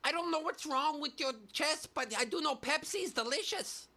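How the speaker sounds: high-pitched celebrity impression; comic high-voiced impersonation. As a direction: put on a high, far-from-your-own voice. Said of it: "[name] is like".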